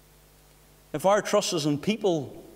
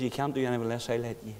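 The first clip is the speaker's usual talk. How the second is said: low, hushed and fast; soft, quick repeat; 8 semitones lower, 8 dB quieter, much faster than usual. Retold or imitated. Preacher repeats the Lord's words hushed and fast. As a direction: drop it to a quick hush. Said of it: "The Lord says".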